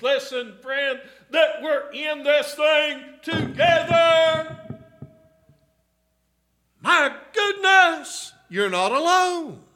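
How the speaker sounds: loud, high, rising preacher's cry to the flock; full-force preaching. Acted. Preacher calling to the congregation about hope, full-force preaching. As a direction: preach it out, pleading and building.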